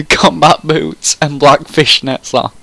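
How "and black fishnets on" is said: ordinary delivery, nothing marked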